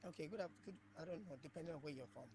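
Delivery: very faint, distant voice; like a weak phone line far away; barely audible. Real, not acted. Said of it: "your voice is very faint".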